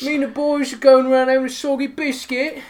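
high, loud mock British slang; hammed-up British accent. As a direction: lampoon British lads.

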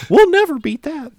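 high, slow tiny voice; squeaky little virus voice; small coronavirus voice. Pretend. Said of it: "why does it have such a small voice?".

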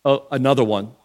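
low, soft, bored shrug; deadpan onlooker, voice dropped low; unimpressed. Acted. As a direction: puff and dismiss it as nothing new. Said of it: "They wouldn't have just been like".

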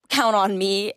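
ordinary talk, nothing marked

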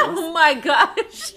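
laughing shock. Real, not acted.

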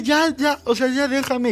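high-pitched, exasperated mock yell; retold yelling. Boyfriend fed up with his ex, mock yelling. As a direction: act out yelling to be left alone. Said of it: "yo le empecé a gritar".

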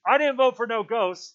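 high, loud trilling outcry; trilled. A narrator's reading. Piggy protests to the assembly, trilling loudly. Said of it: "Piggy's voice trilled".

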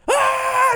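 high, loud mock shriek; mock scream. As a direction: scream it in terror. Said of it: "He screams even more".

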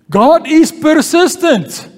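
ordinary talk, nothing marked

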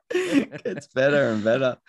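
laughing through the words; can barely talk for laughing. Real, not acted. Laughing at sung jingle.

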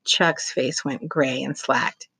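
plain reading voice

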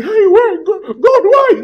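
high-pitched imitated wail of complaint; hammed-up tearful pleading to God; mock crying. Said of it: "You cry, cry, cry, and say".

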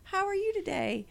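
high, soft and syrupy polite greeting; sweet customer-service voice. Retold or imitated. To the old lady at inspection, high sugary politeness. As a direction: put on the sugary visitor voice. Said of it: "I'm like".

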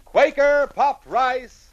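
calling out. Shown as a demonstration. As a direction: call it out to hear the echo. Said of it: "Or like this".

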